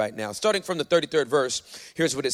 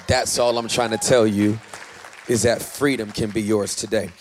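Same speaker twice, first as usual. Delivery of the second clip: ordinary talk, nothing marked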